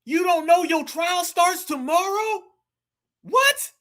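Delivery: jumps way up in pitch, What? What?; real shock at what he just heard, asking it back; stunned disbelief. Real, not acted. Reacting in disbelief to clip.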